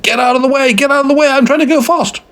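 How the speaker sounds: very high-pitched irate yell; frantic, squawking driver's rant; angry driver. Acted. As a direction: shriek at someone blocking the road. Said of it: "started shaking at his fist".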